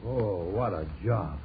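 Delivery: low, slow, weary groan of words; worn out. Acted. Father pausing from sawing, worn out. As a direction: let it sag, spent from hard work.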